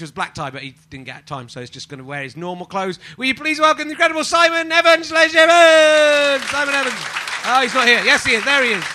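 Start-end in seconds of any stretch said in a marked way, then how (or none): 3.2-8.6 high, loud compère announcement; showbiz introduction. Acted. Host welcoming the guest to the audience, loud announcer voice. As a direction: build up the guest like a game show host.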